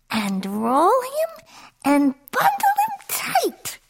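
slow swoop up into a very high voice; third goddess. A narrator's reading. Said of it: "said Bing".

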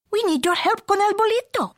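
very high little Spanish-flavoured plea; tiny chihuahua. A narrator's reading. Smallest chihuahua asks for help, high voice. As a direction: plead high like the smallest dog.

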